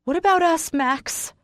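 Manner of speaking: high, voice cracking on Max; voice breaking. A narrator's reading. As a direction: let the voice break on the name. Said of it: "my voice breaks on his name".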